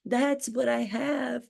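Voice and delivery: ordinary talk, nothing marked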